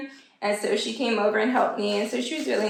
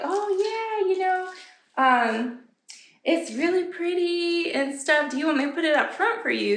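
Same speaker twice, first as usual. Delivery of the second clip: helpful store employee; 7 semitones higher than usual. Retold or imitated. Employee offers to hold it.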